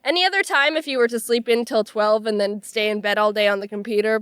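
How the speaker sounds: normal talking voice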